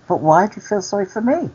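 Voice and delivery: high, quick retold query; acted puzzled question. Storyteller replays questioning the cleaner's pity, high puzzled question. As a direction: ask it pitched right up, quickly.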